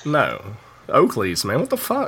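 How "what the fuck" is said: high, baffled "what the fuck"; incredulous. Real, not acted. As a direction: sound completely thrown. Baffled by Oakleys mix-up.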